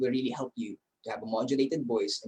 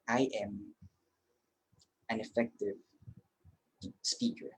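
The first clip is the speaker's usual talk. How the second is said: a calm quiet self-affirmation; 3 semitones lower, 9 dB quieter than usual. Shown as a demonstration. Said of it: "pwede mo bang sabihin to". (mahinahong pagsasabi sa sarili)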